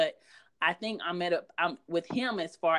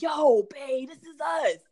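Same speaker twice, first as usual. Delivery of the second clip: high, loud excited husband voice; animated husband; 8 semitones higher, 5 dB louder, slower than usual. Retold or imitated. Husband calling out to wife from the bathroom, excited and high. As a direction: play the husband bursting in, thrilled. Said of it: "He's just really animated".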